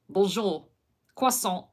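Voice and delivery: lightly put-on French accent; single words with a playful French lilt; mock French. Streamer playing at French for the chat, mock French. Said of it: "It sounds French".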